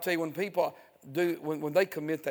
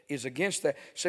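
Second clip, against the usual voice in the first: normal talking voice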